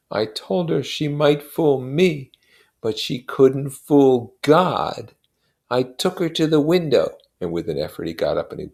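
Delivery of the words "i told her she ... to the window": slow, grave, louder voice; Wilson, solemn and slow. A narrator's reading. Grieving husband telling his friend how he warned his wife, slow and grave. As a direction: lay each word down like a verdict.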